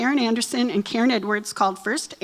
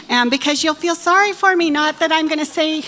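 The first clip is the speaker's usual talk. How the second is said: ordinary talk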